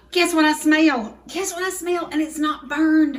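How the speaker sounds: high, loud, delighted announcement; excited. Real, not acted. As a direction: burst out with happy news from the oven. Delighted at smell from the oven.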